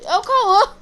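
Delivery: loud happy call-out; excited shout. Real, not acted. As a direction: shout the name, thrilled. Spots a friend.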